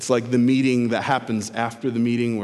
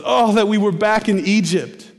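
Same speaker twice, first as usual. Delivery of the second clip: whining Israel; 6 semitones higher, 4 dB louder than usual. Retold or imitated.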